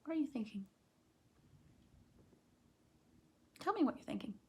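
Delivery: hushed coaxing question; soft question. Real, not acted. Teacher softly asking a child what they are thinking. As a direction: ask gently and quietly.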